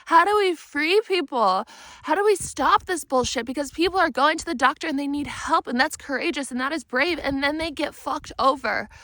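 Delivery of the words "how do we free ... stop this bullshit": higher and louder, fed up; exasperated outcry. Retold or imitated. Asking God in exasperation, higher and louder. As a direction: cry out in frustration.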